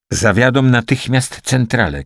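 an understated narrated shout; urgent cry. A narrator's reading. Liza urges the organist to act, urgent cry. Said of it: "krzyknęła".

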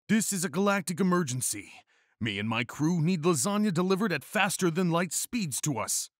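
high, energetic heroic announcement; invigorated declaration. A narrator's reading. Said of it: "declared Garfield with invigoration".

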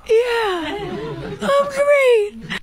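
high, laughing yeah I'm great; high giggly reply. Retold or imitated. Retold reply through laughter.

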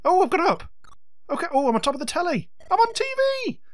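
high, delighted rising cry; excited squeal. Real, not acted. Gamer thrilled to be on top of the telly, squealing. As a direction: squeal with glee at the win.

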